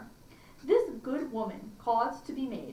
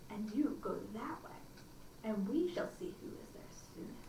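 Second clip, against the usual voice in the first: softer, whispered wolf plotting; scheming whisper; 11 dB quieter than usual. Acted. Wolf whispering his plan to himself. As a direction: hush it like a sly secret plan.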